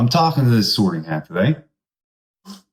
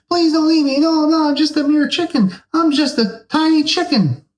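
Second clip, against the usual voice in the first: very high, pleading chicken voice; squeaky chicken. Acted. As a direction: beg like a tiny terrified chicken.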